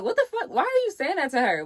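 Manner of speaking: high-pitched indignant aunt; imitated outrage. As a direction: mimic an aunt's shocked challenge. Voices aunt's outraged reaction.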